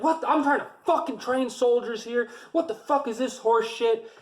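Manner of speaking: high, angry mock rant; imitating a furious drill sergeant; pissed-off rant. Drill sergeant fuming about paperwork, angry high rant. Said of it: "he just got pissed".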